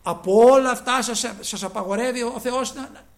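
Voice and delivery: the devil's sly question, loud and rising at the end; mock devil's taunt. Preacher voicing the devil to the congregation, loud mocking taunt. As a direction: throw out the tempter's question loudly to the congregation. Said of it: "ο διάβολος λέει".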